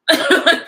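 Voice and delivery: loud high laugh; a real laugh bursting out; sudden loud high laugh; burst of laughter. Real, not acted. Laughs at own slap remark.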